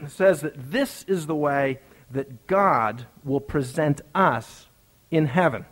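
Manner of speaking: ordinary talk, nothing marked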